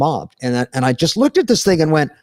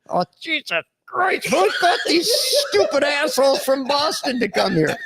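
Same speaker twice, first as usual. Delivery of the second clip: mock exasperation at the rowdy crowd; exasperated Kennedy; 9 semitones higher than usual. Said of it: "that look on his face is just like".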